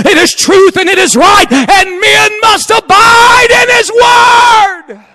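building to high, loud preaching cadence; preacher swelling into a sung climax; rising preaching. Acted. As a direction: climb into a chanted, loud sermon peak.

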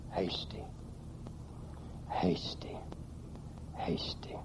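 from a quiet word down to a whisper; fading to a whisper. Acted. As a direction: let the word die away into a whisper. Repeating the word, fading each time.

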